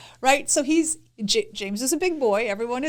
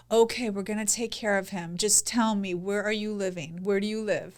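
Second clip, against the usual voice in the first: soft, low, reassuring voice; calm the caller down gently; soothing operator; 5 semitones lower, 7 dB quieter than usual. Acted. Wife plays an emergency operator to a panicked caller, soothing. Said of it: "'cause they're soothing".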